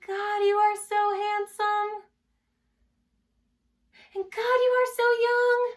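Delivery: swoony, high-pitched gushing, loud and drawn out; swooning thought. Acted. Author recalls gazing at the young man's face, swooning. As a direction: gush it high and loud, held on one pitch.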